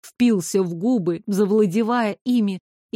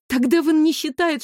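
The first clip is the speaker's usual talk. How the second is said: plain reading voice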